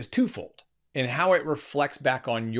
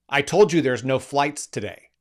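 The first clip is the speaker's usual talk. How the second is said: louder, impatient retort; curt snap; 3 semitones higher, 6 dB louder than usual. Retold or imitated. Service agent cutting off the caller, impatient loud snap. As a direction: bite it off like you're fed up. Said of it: "This was exactly how it came out of her mouth".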